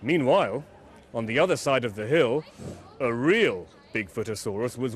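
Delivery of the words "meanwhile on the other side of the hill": ordinary delivery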